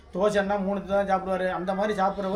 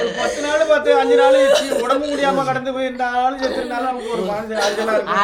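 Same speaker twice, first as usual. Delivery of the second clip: louder, crying out the words; real sorrow pouring out in a wail; loud wailing grief; 3 semitones higher, 9 dB louder than usual. Real, not acted. Mourning, crying aloud.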